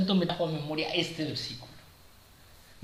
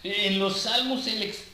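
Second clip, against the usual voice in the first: higher and louder, urging the congregation; fervent preaching; 4 semitones higher, 3 dB louder than usual. Acted. Preacher rebuking the flock, louder and higher. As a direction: drive the point home from the pulpit.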